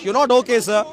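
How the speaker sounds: usual speaking voice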